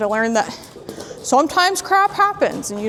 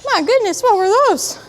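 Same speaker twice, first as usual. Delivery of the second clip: much higher, squeaky startled horse voice; even higher mock alarm; 10 semitones higher, 4 dB louder than usual. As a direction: push the spooked horse voice way up.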